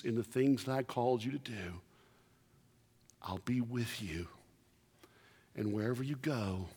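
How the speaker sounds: very soft, hushed promise; God speaking. Retold or imitated. God promising Joshua, soft and hushed. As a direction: murmur it as God comforting Joshua. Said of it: "And he says".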